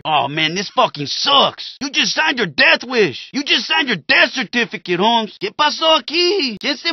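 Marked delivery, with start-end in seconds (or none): none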